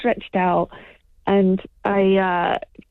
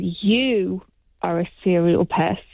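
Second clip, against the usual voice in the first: "you" drawn out slowly, then a short pause; slow, deliberate weight on "you"; stress on YOU; slower than usual. Shown as a demonstration. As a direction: lean on "you", letting it hang. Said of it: "The emphasis being on the you".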